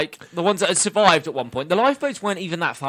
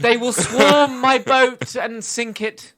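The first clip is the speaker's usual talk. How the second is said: theatrical hero; 7 semitones higher, much slower than usual. Pretend. Continues the dramatic hero bit.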